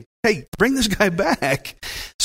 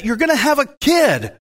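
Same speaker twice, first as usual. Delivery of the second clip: excited announcement; 9 semitones higher than usual. Retold or imitated. Wife retelling angel's big news.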